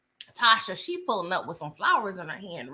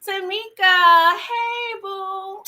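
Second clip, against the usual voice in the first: a drawn-out delighted welcome, much higher; excited greeting; 9 semitones higher, 4 dB louder, much slower than usual. Real, not acted. Host greeting Tamika in the chat, delighted and drawn out.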